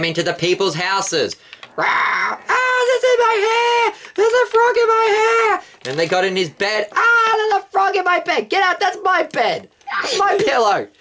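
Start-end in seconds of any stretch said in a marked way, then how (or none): 1.8-5.6 panicked shriek. Acted. Egyptian screaming at a frog in her hair, high panicked squeal. 6.9-10.9 panicked shriek. Acted. Egyptian screaming at a frog in her hair, high panicked squeal. Egyptian finds frogs in bed.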